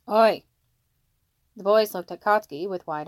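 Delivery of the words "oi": loud barked oi; sharp call. Acted. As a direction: stop the kids with one word. Father calls boys to order.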